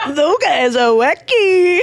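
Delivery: slow, light mock-casual dismissal; breezy jokey brush-off; flippant jokey tone. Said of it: "that would not be the right tone".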